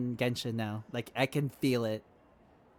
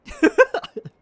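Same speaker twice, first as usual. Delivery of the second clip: sudden high burst of laughter; loud laugh; 12 dB louder than usual. Real, not acted. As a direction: crack up loudly at the game.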